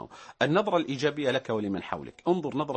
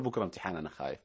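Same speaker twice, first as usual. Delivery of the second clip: low, faint, fearful "أنا خايف"; worried student; 8 semitones lower, 6 dB quieter, faster than usual. Retold or imitated. Host voicing a frightened student before an exam, low and anxious. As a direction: sound scared of tomorrow's exam. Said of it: "لا تقول".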